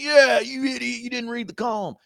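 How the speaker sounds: high, put-on mocking jeer; mocking crowd. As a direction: mimic the online pile-on in a squeaky-high voice. Mimicking the online pile-on.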